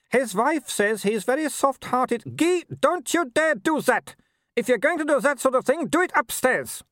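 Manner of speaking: high, imperious scolding; scolding Baroness. A narrator's reading. Mother scolding husband for changing, high imperious voice. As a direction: order him about like an errant dog.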